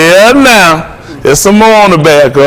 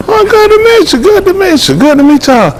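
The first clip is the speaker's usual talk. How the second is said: much higher, sweet welcoming voice; high sing-song greeting. Acted. Greeting Tasha and the family in a much higher, sugary voice. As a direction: lift way up into a syrupy hello.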